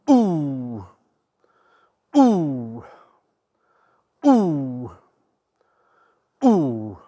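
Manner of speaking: a drawn-out "ooh" on each swing; effort sounds. Shown as a demonstration. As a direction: breathe an "ooh" through every swing. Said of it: "effort sounds during a demo swing".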